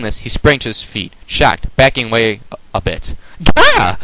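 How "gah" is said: high, loud startled "Gah!"; startled scream. Acted. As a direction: yelp in shock at ponies. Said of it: "the creature screamed".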